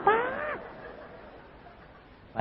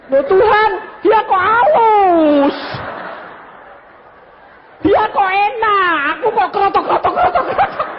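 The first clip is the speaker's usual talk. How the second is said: jealous protest; 6 dB louder than usual. Retold or imitated. Husband protesting to God that his tongues sound rough, high whine. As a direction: complain to God half laughing, feeling cheated. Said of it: "aku berhenti".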